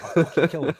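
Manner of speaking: loud, laughing imitation of a furious dad; laughing mock threat. Son repeating dad's threat, laughing loud mock shout. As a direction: shout the threat while cracking up.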